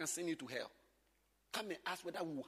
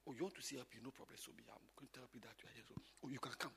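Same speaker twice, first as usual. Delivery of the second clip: soft administrator voice; 8 semitones lower, 14 dB quieter, faster than usual. Acted. Acting administrator.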